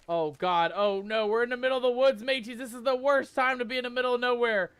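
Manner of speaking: loud, high panicky outburst; panicked. Real, not acted. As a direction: panic as the night closes in. Panicking alone in dark woods.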